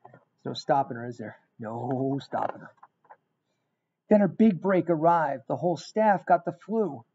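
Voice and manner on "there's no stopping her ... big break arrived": plain reading voice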